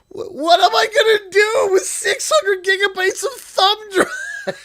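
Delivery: high, loud, crying with laughter; helpless laughing shout. Real, not acted. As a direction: shout the question while cracking up. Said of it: "I'm laughing".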